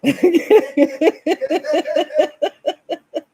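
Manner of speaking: long, loud, high laughing fit; helpless laughter. Real, not acted. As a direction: crack up completely, high and loud. Cracks up at her own story.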